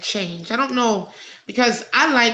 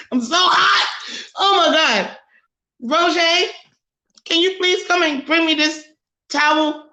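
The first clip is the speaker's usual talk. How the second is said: calling out, overheated; 5 semitones higher than usual. Real, not acted. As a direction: laugh and call for the towel, flustered. Overheated, calls out to Roger.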